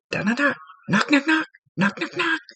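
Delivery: high, rising mock knock knock. Medium imitating a cop on the TV news, knocks climbing high. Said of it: "some cop is going".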